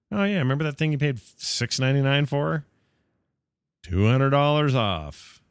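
lightly hammed-up company spokesman; mock offhand announcer.

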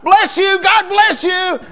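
high, loud, sing-song shout of a blessing; mock blessing yell. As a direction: shout it sweetly instead of cursing. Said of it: "I should have yelled out".

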